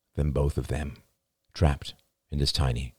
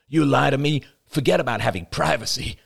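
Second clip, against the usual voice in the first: heated, higher-pitched retort; angry growl; 9 semitones higher than usual. A narrator's reading. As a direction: lay down the law in anger. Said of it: "he growled".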